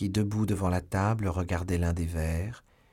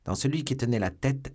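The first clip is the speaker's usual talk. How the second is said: plain reading voice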